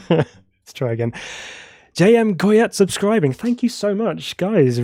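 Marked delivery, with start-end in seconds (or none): 1.9-4.8 higher, slower, beaming gratitude; light up thanking the new subscriber; delighted thanks. Real, not acted. Streamer thanks a new subscriber with real delight.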